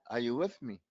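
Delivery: ordinary delivery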